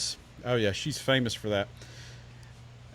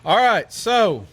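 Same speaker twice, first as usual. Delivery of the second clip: ordinary delivery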